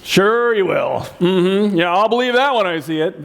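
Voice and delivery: slow, sneering "Ugh, sure you will"; a slow sneer of disbelief at a partner; scoffing. Retold or imitated. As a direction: scoff at them, drawn out and disbelieving.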